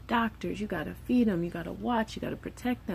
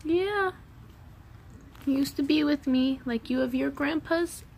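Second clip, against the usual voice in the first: higher, gentle mother's voice; gentle explaining to son; 7 semitones higher than usual. Retold or imitated. Mother telling her son about his dead grandpa, gently higher. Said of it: "And I'm like".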